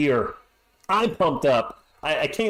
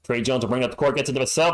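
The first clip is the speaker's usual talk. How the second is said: ordinary delivery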